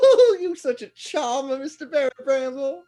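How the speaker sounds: high, loud, giggling flattery; a tittering old lady charmed by a flatterer; laughing flirt. Acted. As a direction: coo the compliment through a laugh. GM's NPC flirts, laughing.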